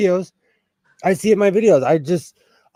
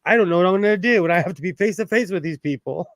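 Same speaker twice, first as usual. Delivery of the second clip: ordinary talk, nothing marked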